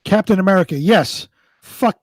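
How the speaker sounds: usual speaking voice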